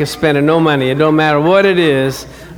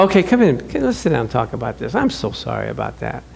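soft apology; 7 semitones lower, 6 dB quieter than usual. Retold or imitated. Consoling wife after sabotage.

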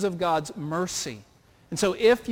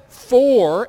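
For punched out much louder; stress on For; 4 semitones higher, 11 dB louder than usual. Shown as a demonstration. Preacher stressing the key word For to the congregation, loud. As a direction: hit the word For hard, it carries everything. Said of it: "that little word that has big significance".

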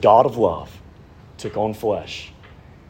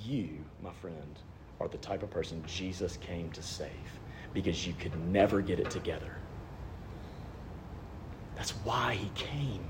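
much softer and lower, nearly whispered; hushed; 6 semitones lower, 19 dB quieter than usual. Real, not acted. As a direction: lean in and tell them gently. Tender direct address to listener.

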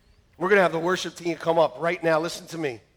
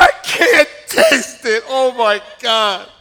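mock dismay; 6 semitones higher, 11 dB louder, much slower than usual. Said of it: "I'm like".

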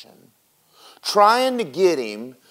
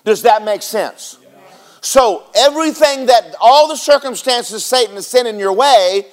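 rising, louder preaching cadence; 6 semitones higher, 3 dB louder than usual. Acted. Pastor driving the point home to the congregation, preaching. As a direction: preach it with full fire.

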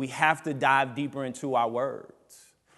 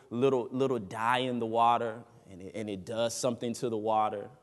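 ordinary talk

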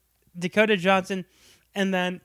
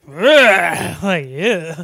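loud disgusted ugh; 9 dB louder, much slower than usual. Real, not acted. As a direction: recoil in disgust. Disgusted reaction.

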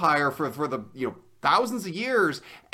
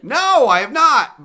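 loud defiant denial; 8 semitones higher, 10 dB louder, slower than usual. Real, not acted. Answers the incredulous host.

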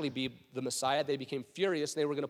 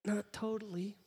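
small, soft hesitant voice; timid dissent; 3 semitones higher, 8 dB quieter than usual. Acted. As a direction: object barely audibly, afraid to be noticed. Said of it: "Nicodemus is like".

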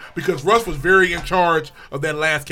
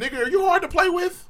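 high-pitched mock challenge; acted questioning; 11 semitones higher than usual. Team questioning Russ's leadership, high-pitched acted question. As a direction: pitch it well up, as a quoted question.

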